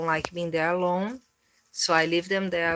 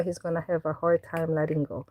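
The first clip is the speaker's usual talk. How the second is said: ordinary delivery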